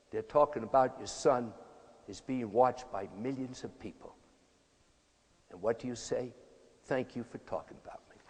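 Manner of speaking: a quiet father soothing his upset wife; very calm voice. Retold or imitated. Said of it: "in a very calm voice".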